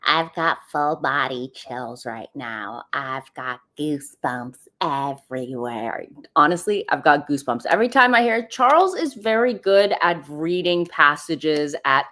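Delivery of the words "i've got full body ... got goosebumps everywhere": Jamie Kern Lima. Acted. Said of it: "do it in the Jamie Kern Lima voice".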